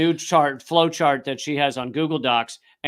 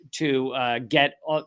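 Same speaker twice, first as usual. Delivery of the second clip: ordinary delivery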